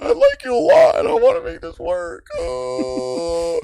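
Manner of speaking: very high, wailing fake sob; over-the-top fake cry. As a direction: squeal it out through mock tears. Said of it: "I just fake cried for that".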